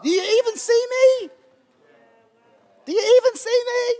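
begging to be seen, voice lifted high; emotional plea. Retold or imitated. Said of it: "I get a bit emotional at times with God".

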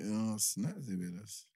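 ordinary talk, nothing marked

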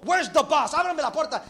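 demanding Job. Acted. Job demands entry to God.

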